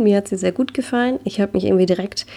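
plain reading voice

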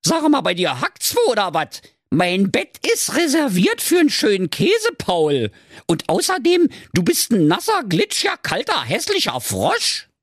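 high-pitched outraged princess; indignant high character rant; Carola Knackwurst. A narrator's reading. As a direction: scold the frog in a high diva voice. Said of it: "Und die Carola rief".

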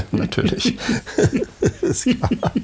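high-pitched giggling sarcasm; laughing. Real, not acted. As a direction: laugh it off, mock agreement. Mocking naked-cleaner idea. (lachend, hoch und spöttisch)

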